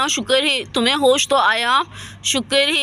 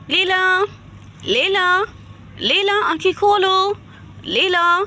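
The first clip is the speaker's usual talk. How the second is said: calling to rouse someone unconscious, pitched higher; 4 semitones higher, slower than usual. Acted. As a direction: urge her to wake, rising calls with pauses. Doctor calling unconscious Leela. (होश में लाने के लिए पुकारते हुए)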